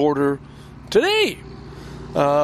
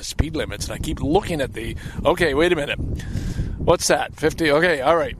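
ordinary talk, nothing marked

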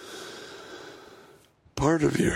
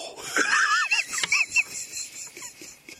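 squeaky cracked laugh. Real, not acted.

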